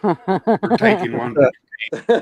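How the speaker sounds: slow laughing tease. Real, not acted. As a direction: chuckle through it. Laughing teasing line.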